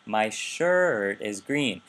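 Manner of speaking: "shirt" stretched, pitch going up and down; slow focus intonation on "shirt"; focus stress on SHIRT. Shown as a demonstration. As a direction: hit and draw out "shirt". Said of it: "focus intonation that's kind of going up and down".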